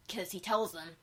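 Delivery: ordinary delivery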